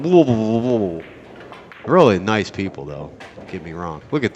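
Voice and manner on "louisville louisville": put-on mushy, consonant-swallowing Louisville accent; garbled Louisville accent; mock Louisville accent. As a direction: caricature locals slurring their town's name in Louisville accent. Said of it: "That's what they sound like, some of them".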